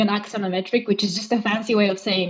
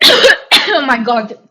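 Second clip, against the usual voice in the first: choking cough; 3 semitones higher, 8 dB louder, much slower than usual. Real, not acted. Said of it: "Almond dust stuck in my throat".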